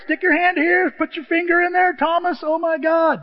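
very high, loud frightened squeal; a hammed-up panicked disciple, very high and loud; mock panic. Panicked disciples and Thomas scene.

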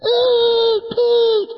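put-on high kid's yell, bright and sudden; child's high-pitched call; grandkid's shout. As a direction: shout it like an excited grandchild at the table. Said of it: "They put their elbows on the table".

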